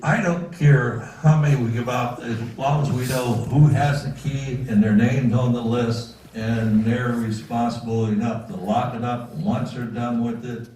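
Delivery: ordinary delivery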